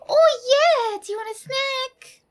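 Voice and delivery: very high excited pet talk; dog voice. Real, not acted. Offering her dog a snack, very high dog voice. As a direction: gasp and squeal it to the dog. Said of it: "your dog voice".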